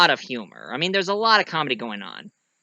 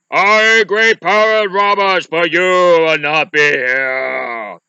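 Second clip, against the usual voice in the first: loud, slow booming proclamation; booming threat; 3 semitones higher, 9 dB louder, slower than usual. Acted. Cloaked giant scaring off the brute squad, booming shout. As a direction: draw the last words out at full volume.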